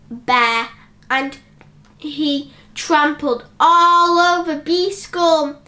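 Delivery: usual speaking voice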